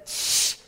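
short, insistent "shh"; hushing. Acted. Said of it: "didn't we tell you to be quiet".

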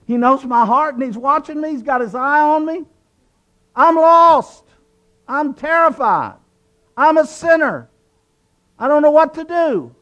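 high-pitched, acted-out terror; imitating a frightened boy's rising panic; panicked outcry. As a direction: wail it like a convicted young sinner. Acting out his boyhood terror in church.

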